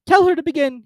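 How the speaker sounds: high, loud creature voice; Mock Turtle voice. A narrator's reading.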